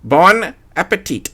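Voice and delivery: put-on high, showy French-accented Bon appetit. A narrator's reading. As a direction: greet them in a smug French accent. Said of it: "perfectly annoying French accent".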